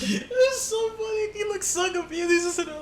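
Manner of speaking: high, squeaky voice through laughter; laughing hard. Real, not acted. Recalling the confused panhandler, helpless real laughter. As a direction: barely get the words out for laughing.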